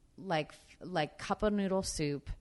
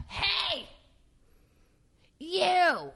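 high, far-carrying mock call; imitating Roseanne yelling hey you; calling out across a set; 6 dB louder than usual. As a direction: shout across a huge stage.

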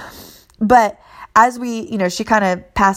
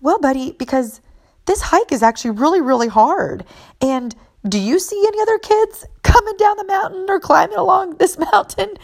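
put-on talking to a child; 10 semitones higher than usual. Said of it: "And I go".

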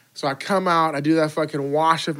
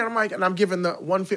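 normal talking voice

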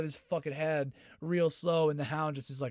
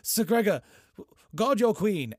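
commanding Qyburn; 7 semitones higher, 8 dB louder than usual. Retold or imitated. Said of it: "And he's like".

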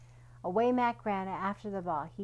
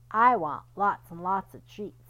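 greedy wish; 10 dB louder than usual. Acted. Greedy Mac.

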